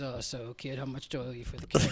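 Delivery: gruff low-voiced old bar owner. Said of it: "got a gruff voice and he goes".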